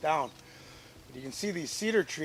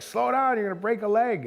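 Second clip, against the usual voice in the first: high, raised re-enacted warning; urgent warning; 9 semitones higher, 3 dB louder than usual. Brother warning his sister on the driftwood, calling out urgently. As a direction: call after someone rushing off.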